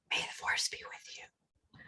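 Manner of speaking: slow unvoiced whisper; hushed whisper down the phone. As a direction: murmur it like a secret blessing. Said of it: "I kind of whispered".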